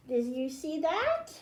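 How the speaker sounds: normal talking voice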